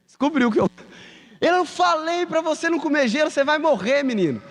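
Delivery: high, sharp scolding mom; mother scolding. Retold or imitated. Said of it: "minha mãe grita". (mãe brava dando bronca no filho, voz bem aguda)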